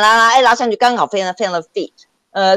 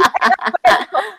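nervous laugh; 14 semitones higher than usual. Real, not acted. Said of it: "nervous laugh after the awkward scene".

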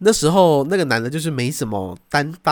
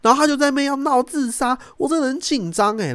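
put-on high, girlish voice, much higher; imitating the friend; 13 semitones higher than usual. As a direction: play the flustered friend telling it. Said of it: "他朋友就说". (模仿朋友高声讲述)

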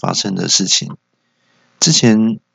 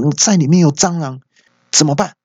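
higher, a touch of mock anger; angry complaint; 7 semitones higher than usual. Angry diner confronts the waitress over a cockroach, mildly mock-angry. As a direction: lightly play the angry diner. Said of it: "就很生气的把服务生叫过来".

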